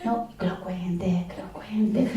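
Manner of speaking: soft, slowing whisper of impatient listeners; hushed urging. Retold or imitated. Audience members urging the speaker to tell, whispered. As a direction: beg quietly for the secret. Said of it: "dice la gente".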